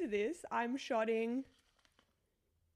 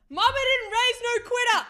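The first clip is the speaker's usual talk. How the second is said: loud pep talk; 11 semitones higher, 9 dB louder than usual. Pretend. Psyching up before a shot.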